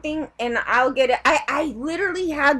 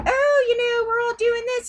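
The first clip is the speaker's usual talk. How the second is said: high sing-song mock of a smug organization; mocking ACLU voice; 8 semitones higher than usual. Ranting vlogger mocks the ACLU's excuses, high and sing-song. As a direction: mimic their fake-nice tone, pitched up. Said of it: "they always act like".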